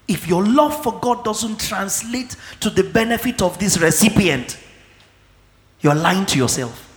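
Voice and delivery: usual speaking voice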